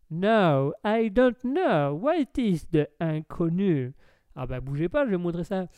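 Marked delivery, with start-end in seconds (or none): 0.1-3.9 loud, slow mock English accent. English lord asked about comedians, hammed-up English accent. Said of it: "vous connaissez pas Les Inconnus".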